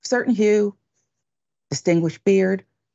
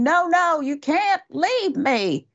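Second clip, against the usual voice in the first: high-pitched mock yell, last words drawn out; 9 semitones higher, slower than usual. Host retelling a lady running to the gatehouse, mock yelling. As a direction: imitate a panicked traveler's yell, pitched high.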